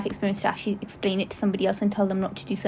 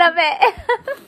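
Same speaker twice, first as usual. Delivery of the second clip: laughing, pitched up; 7 semitones higher than usual. Real, not acted. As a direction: giggle it out. Laughing at their sync.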